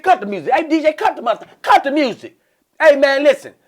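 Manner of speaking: loud, high commanding shout; mock hype man; cocky stage yell. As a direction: bark orders at the DJ. Cocky comic yelling at DJ.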